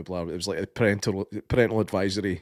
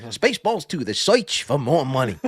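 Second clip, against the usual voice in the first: lightly voiced Mel Brooks impression, search said soych; a hint of Mel Brooks Yiddish comic accent, high on Spaceballs; mild Mel Brooks accent for a laugh; 4 semitones higher, 3 dB louder than usual. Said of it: "that Mel Brooks accent, like, replacing R's with Y's".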